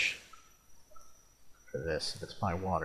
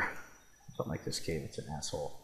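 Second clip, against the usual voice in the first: grumbling mutter; 8 semitones lower, 16 dB quieter than usual. Real, not acted. Grumbling at the cave.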